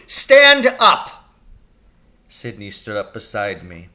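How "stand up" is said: loud, high command; shouted order. Acted. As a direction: bark the order. Teacher ordering Sidney up.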